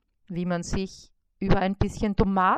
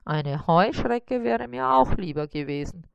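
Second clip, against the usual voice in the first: shrugging spider. A narrator's reading. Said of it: "sagte Karl-Heinz".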